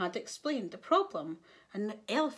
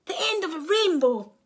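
higher, louder storybook hero; eager Elmer; 7 semitones higher, 8 dB louder than usual. A narrator's reading. Elmer tells the lion what he is seeking, eagerly. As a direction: answer with high, emphatic energy.

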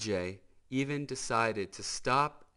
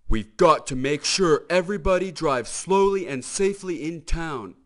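louder, higher stern lawman; Sheriff's voice; 8 semitones higher, 11 dB louder than usual. Acted. Sheriff addresses friends in the courthouse, stern and louder. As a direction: lay down the law to the town.

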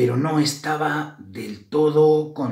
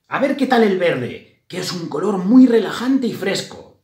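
higher, louder storybook mouse voice; hopeful mouse; 8 semitones higher, 7 dB louder than usual. A narrator's reading. Mouse Grisela tries green next, hopeful. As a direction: think aloud as an eager little mouse.

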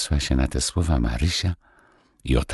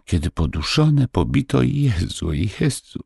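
put-on a girl sobbing over her lost geese; a child wails in grief, pitched high; crying out; 11 semitones higher than usual. A narrator's reading. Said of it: "zaniosła się na to wielkim płaczem".

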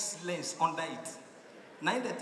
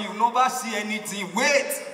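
boastful; 3 semitones higher, 9 dB louder than usual. Retold or imitated. Old driver boasting to the visitor, loud teasing. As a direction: brag that the best is still to come.

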